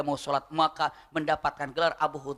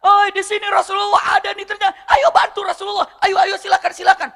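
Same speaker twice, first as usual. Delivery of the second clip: much higher, loud rallying shout; shout for help; 13 semitones higher, 10 dB louder than usual. Retold or imitated. Abu Hudzaifah bluffing to scare the attackers, loud shout. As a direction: yell out as if calling a whole army. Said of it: "beliau teriak".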